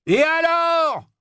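very high, drawn-out shout; furious yell. A narrator's reading. Barman confronting a masked figure in his street, yelling. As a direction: bellow it long into their face. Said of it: "hurlait-il au visage".

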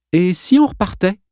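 lightly polite ask; polite suggestion. A narrator's reading. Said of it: "suggéra Jim poliment".

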